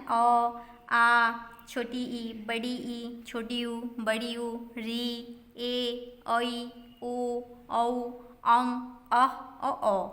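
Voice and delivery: put-on slow, drawn-out vowel recitation for children; a teacher reciting the vowel chart to the class. Shown as a demonstration. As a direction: sound out each Hindi vowel slowly and clearly. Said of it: "ये है, क्या है ना".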